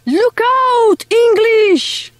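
put-on high French-accented warning shout; yelling. A narrator's reading. French mice warning the English boat, yelling. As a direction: call out high in alarm. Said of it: "yelled the French".

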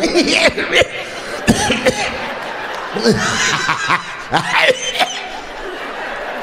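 high breathy imitation of her giggle; mock lady laugh. Comic imitating the woman laughing at her husband. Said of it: "She's like".

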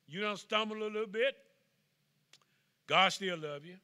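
loud, high preacher's climb; preaching at full force. Acted. Preacher assuring the slipping flock, loud and high. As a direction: lift the room with it.

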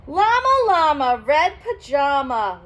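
plain reading voice

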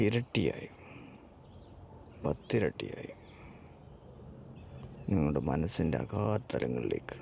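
soft, unhurried meditation voice with long pauses; guided meditation. Acted. Leads a countdown meditation.

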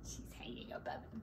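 breathy hushed aside; whisper. Real, not acted. Reader to children, pointing at the picture: whispered aside.

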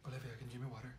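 whispering. Acted. Shy guest asking friend for water, whispering. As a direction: whisper it so only your friend hears. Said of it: "Why are you whispering?".

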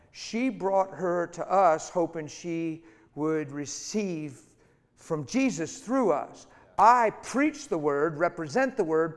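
fiery revival preaching; full-force preaching. Acted. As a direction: thunder it out from the pulpit.

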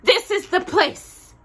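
high, loud and slow discovery; excited cry. A narrator's reading. Raggedy Ann reaching the pantry door, high and loud. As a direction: announce the find. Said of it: "cried Raggedy Ann".

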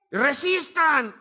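slow, high, drawn-out battle command; commander's shout. Acted. Spartan leader to his soldiers as they clash, slow commanding shout. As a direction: bellow it to keep the line firm.

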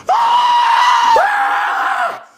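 panicked yelps. Acted. Toad panics.

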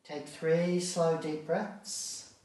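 normal talking voice

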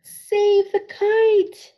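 high, loud, slow shout. Acted. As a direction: yell out to save the kite. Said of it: "shouted Mole".

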